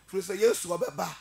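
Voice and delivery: ordinary delivery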